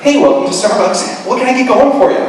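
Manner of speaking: chirpy barista greeting. Retold or imitated. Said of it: "I had my barista voice".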